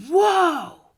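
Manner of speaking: a sudden high, loud whoa; startled outcry. Retold or imitated. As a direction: jolt up loud, as if injustice just hit you. Blood boiling at social injustice.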